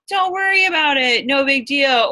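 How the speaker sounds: high, loud breezy brush-off. Retold or imitated. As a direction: wave it away like the cool boss. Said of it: "it's easy to either be like".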